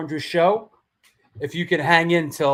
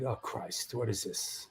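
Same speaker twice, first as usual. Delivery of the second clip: whispered, dreading mutter; dismayed whisper; 7 semitones lower, 15 dB quieter than usual. Retold or imitated. Host recalls bracing for the next text, whispered dread. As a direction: breathe it out in dread.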